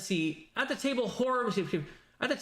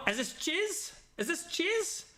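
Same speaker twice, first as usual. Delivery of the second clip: put-on a light Borat accent, higher pitched; 3 semitones higher than usual. Streamer demoing a lightly done Borat accent, higher. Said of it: "this character sounds like Borat".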